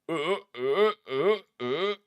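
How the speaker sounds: mimicking a dog's warning retches; dog retching. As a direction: heave like a dog about to throw up. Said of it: "normal dogs are like, go".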